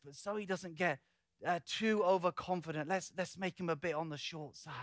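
acted soft, confidential suggestion; sly aside. The Spirit suggesting he be made short, slyly hushed. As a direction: drop the volume as if plotting a little joke.

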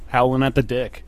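ordinary delivery

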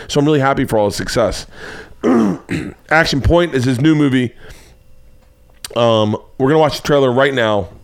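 ordinary delivery

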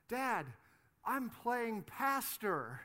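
put-on high, slow innocent kid. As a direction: say it like a proud little boy. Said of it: "he goes".

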